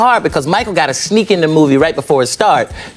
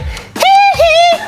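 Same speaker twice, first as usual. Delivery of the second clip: high, loud squeaky giggle; tittering high laugh; Michael's giggle; 5 dB louder than usual. Retold or imitated. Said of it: "he's watching the movie like".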